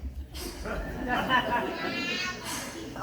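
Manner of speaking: soft breathy giggle that runs on; long real laugh. Real, not acted. Reader cracking up after Demetrius leaves, soft laughter. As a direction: laugh it out quietly.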